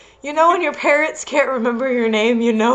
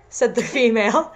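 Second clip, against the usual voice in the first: ordinary talk, nothing marked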